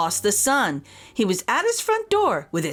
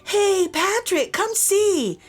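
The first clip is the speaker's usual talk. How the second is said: higher, slow call across the park; 10 semitones higher, slower than usual. Acted. Friend Donna calls Patrick over to see her drone. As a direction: call him over from a distance. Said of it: "he heard a voice call".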